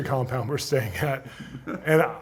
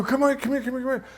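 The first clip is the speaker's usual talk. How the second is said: high, rapid repeated calls; urgent beckoning; 12 semitones higher than usual. Retold or imitated. Calling Tyler to the window to see, urgent high beckoning. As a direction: act out calling a friend over urgently.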